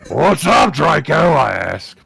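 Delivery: high, loud orc greeting. Acted. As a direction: call out as an orc. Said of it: "I'll do it in the orc voice".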